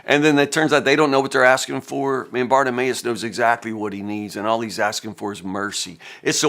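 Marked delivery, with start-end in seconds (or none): none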